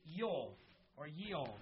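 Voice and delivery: Yoel and Yeol with a Y sound; Hebrew pronunciation. Shown as a demonstration. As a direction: say Yoel then Yeol, starting with Y. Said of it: "Joel's name is".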